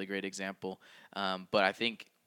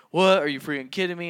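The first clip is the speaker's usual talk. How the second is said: louder, higher mock outrage; barking at wife; 9 semitones higher, 10 dB louder than usual. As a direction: bark it at her in disbelief. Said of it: "like, barking at her".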